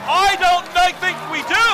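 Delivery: high, loud disbelieving shout; excited argument. Real, not acted. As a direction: argue the finish live. Arguing over the result.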